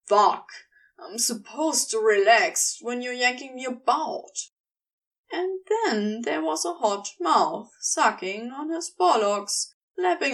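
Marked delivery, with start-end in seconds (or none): 0.1-4.3 Crowley's voice. A narrator's reading. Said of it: "Crowley protests, exasperated".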